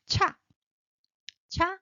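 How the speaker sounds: "Cha" with a burst of air; short, aspirated "Cha"; aspirated, rising. Shown as a demonstration. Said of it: "burst of air, rising".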